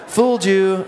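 playful taunt. Pretend. Believer gloats that the devil was fooled, playful taunt. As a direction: crow it like a prankster.

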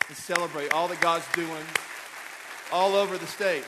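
loud, building call to clap; rousing applause call. Real, not acted. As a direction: lift the room into applause. Calls the congregation to applaud.

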